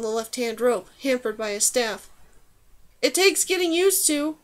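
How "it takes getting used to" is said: higher-pitched call to a companion. A narrator's reading. Calling to the girl behind on the bridge, raised calling voice. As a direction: call it across to her, without shouting. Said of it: "he called to her".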